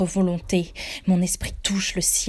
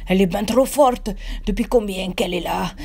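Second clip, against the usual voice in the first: golem Hicham; 3 semitones higher, 4 dB louder than usual. A narrator's reading. Golem Hicham panicking, grabbing the narrator's leg. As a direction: panicky sidekick with a rough accent.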